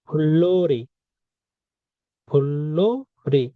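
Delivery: ফুল্লোরি drawn out slowly with a doubled l; slow model pronunciation. Shown as a demonstration. Teacher slows the word down for students. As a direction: stretch ফুল্লোরি, pul-lo-ri. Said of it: "তো এটা কি হবে?".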